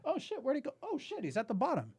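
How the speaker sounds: sudden alarm of a parent at the pool; imagined panicked parent. Pretend. Imagined parent discovering drowning.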